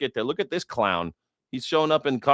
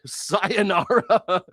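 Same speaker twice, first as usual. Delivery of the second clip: drawn-out mock farewell; 8 semitones higher, much slower than usual.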